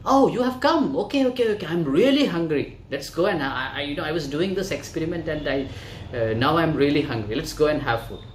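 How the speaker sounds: excited scientist. Acted. Scientist greets his waiting friend, loud and excited. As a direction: gush it out like the hungry scientist. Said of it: "Then he goes".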